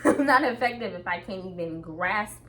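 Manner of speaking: ordinary delivery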